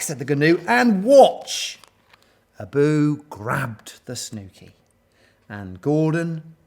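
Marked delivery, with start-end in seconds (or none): 0.7-1.8 loud, high, impatient telling-off; exasperated story-animal command; scolding. A narrator's reading. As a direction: shush a chatterbox sharply. Gnu scolds the chattering yak.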